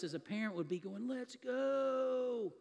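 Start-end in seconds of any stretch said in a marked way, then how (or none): 1.1-2.5 inner urgency. Retold or imitated. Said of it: "he's inside, as any of us".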